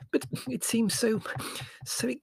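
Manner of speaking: high, halting objection; falter, then get the worry out slowly; hesitant. A narrator's reading. Mother doubting the move after a pause, hesitant. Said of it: "she said at last".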